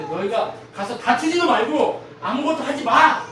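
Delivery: building to a loud, high order; stern command. Acted. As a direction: lay down the law, rising to a shout. Voices God's command to the people.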